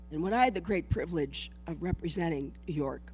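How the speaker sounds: ordinary talk, nothing marked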